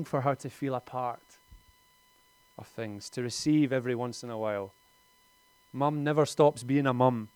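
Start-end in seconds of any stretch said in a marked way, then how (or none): none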